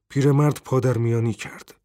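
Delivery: ordinary delivery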